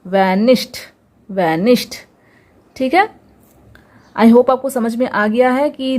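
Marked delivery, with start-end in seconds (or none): 0.0-0.8 वैनिश्ड pronounced "vanisht"; final D as T. Shown as a demonstration. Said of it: "डी का sound क्या होगा". 1.3-1.9 वैनिश्ड ending in "sht"; final D as T. Shown as a demonstration. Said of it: "डी का sound क्या होगा".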